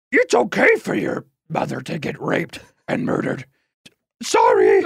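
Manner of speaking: a hint of a wise puppet master, lightly voiced; puppet Yoda voice. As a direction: croak it out like a little puppet sage. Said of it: "Okay, Master Yoda".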